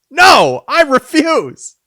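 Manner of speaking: high, loud outburst of protest; mock refusal. Balking at the next silly name, loudly indignant in play.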